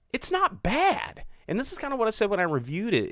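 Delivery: ordinary talk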